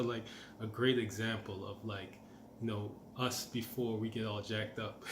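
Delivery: usual speaking voice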